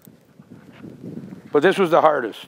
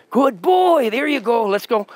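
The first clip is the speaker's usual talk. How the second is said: bright, much higher praise for the dog; high sing-song praise; 11 semitones higher than usual. Real, not acted. Trainer cheering on his dog, in a high excited voice. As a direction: lift it way up like cheering on a puppy.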